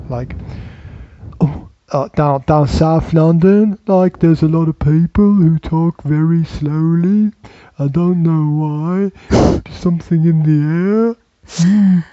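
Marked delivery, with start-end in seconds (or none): 1.4-12.0 lightly put-on South London accent, slowed; a hint of a slow South London cabbie; mock South London accent. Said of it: "like, London accents".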